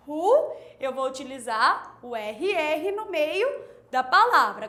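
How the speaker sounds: ordinary talk, nothing marked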